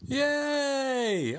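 high, bright shout of victory; cheer. Real, not acted. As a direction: celebrate winning the quiz. Cheering his quiz win.

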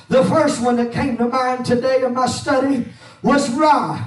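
rhythmic preaching with an "uh" on each breath; full-force preaching. Acted. Pastor preaching to his church, full-force and rhythmic. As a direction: preach it out to the church over the organ. Preaching over organ music.

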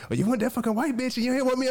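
fast, high-pitched imitation of outrage; mock jealous tirade. Jealous woman confronting him in public, mock outrage. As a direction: rattle off the accusation in a high voice.

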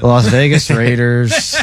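slow deadpan monotone. Pretend. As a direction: read it out slow, droning and flat. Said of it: "in a monotone voice".

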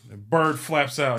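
normal talking voice

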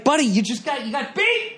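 high, mocking jab at a beaten player; mock trash talk. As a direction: sneer it like telling off a loudmouth. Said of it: "It's like".

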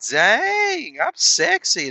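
high, hammed-up boast; mock vain self-praise. Dieter admiring himself after fifty pounds, mock boast. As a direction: preen like you just saw the mirror. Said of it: "be like".

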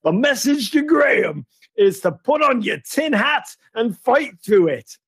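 high, hammed-up American voice; crummy mock-American rallying speech; crap American accent. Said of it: "doing this in a crap American accent".

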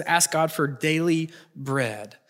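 ordinary talk, nothing marked

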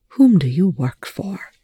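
voiceless pointed question; whispered accusation. A narrator's reading. Rush pressing Telford in a whisper about his loyalties.

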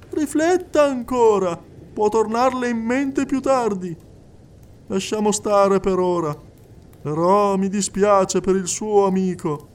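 high, insistent clairvoyant addressing the hall; a medium coaxing a silent widow; medium's voice. Acted. Medium speaking to the crowded séance hall, high and insistent.

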